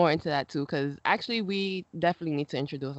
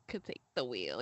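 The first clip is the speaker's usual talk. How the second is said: ordinary talk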